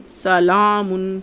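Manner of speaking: سلامن said slowly, the لا held twice as long; slow teaching recitation of سلامن; doubled vowel. Shown as a demonstration. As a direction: stretch the لا in سلامن to double length. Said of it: "اس کو تھوڑا سا دگنا لمبا کر لیتے ہیں".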